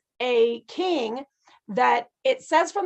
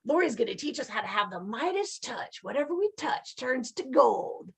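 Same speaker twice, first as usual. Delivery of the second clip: softer, hushed mock excitement; mock eager students; 6 dB quieter than usual. Teacher mimics eager students, softer mock excitement. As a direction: play the students naively hoping for riches. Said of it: "we're like".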